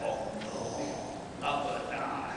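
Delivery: soft, voiceless dread; whispered dread. Retold or imitated. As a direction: whisper it to yourself in panic. Scared thought on hearing invitation.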